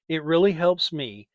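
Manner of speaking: plain reading voice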